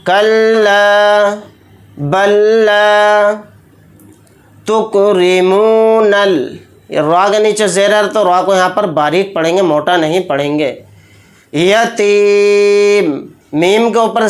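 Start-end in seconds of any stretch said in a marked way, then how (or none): 0.1-6.3 slow melodic chant, pitched higher; Quran recitation. Acted. Teacher chanting the verse for students to follow. 11.5-13.3 यतीम drawn out with the final म held silent; pausal stop. Shown as a demonstration. Teacher showing the stop on यतीम.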